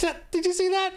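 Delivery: high, incredulous squeal; can't believe what he just saw; amazed. Real, not acted. Reacting to a clip, incredulous high-pitched squeal.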